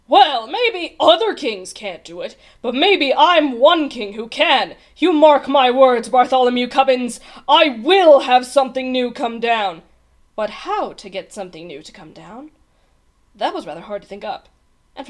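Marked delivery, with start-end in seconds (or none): 0.1-9.8 loud, raised, defiant royal vow; terrible rage. A narrator's reading. King vowing to rule the sky, raging. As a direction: declare it loudly in a fury. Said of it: "His Majesty flew into a terrible rage".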